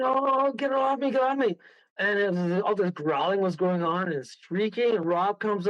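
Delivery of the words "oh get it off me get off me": high-pitched imitated cry for help; retold panic. Medium grabbed by the throat, retold panicked plea. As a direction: act out a strangled woman's alarm. Medium being strangled by the demon.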